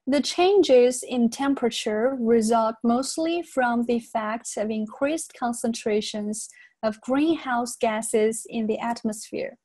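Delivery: slow, careful model reading for learners; unhurried English dictation pace with clear detail. Shown as a demonstration. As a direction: read slowly, articulating each sound. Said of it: "再来慢速的读一遍".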